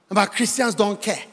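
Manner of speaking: ordinary delivery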